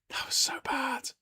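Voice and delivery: breathless high whisper of "That was so bad"; hushed whispered repeat. Shown as a demonstration.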